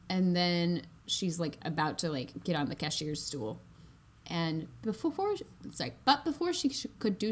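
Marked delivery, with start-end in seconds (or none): none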